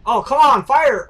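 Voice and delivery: loud, high yell of frustration; real angry gamer outburst; frustrated shout. Real, not acted. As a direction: shout at the screen in exasperation. Frustrated at game moment.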